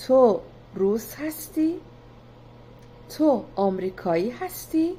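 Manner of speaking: تو روس هستی؟ ends with the pitch going up on هستی; spoken Persian question carried by a rising tune; rising question intonation. Shown as a demonstration. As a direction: ask it only with the rising tune. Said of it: "if I raise the intonation".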